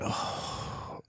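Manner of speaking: soft, breath-only "oh"; deflated sigh. Retold or imitated. Dad imitating son getting the point, deflated sigh. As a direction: let the air out, imitating the boy. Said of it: "you could just see him like".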